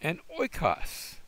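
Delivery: "oikos" drawn out slowly and high; teacher modelling the oi diphthong; slow model word. Shown as a demonstration. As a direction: sound out "oikos". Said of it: "the first two letters form my diphthong".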